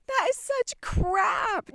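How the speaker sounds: high, slow and scornful; scornful dismissal. Real, not acted. Vlogger dismissing society's jokes about aging, scornful. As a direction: spit out the scorn, slowly. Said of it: "rejecting ageist jokes".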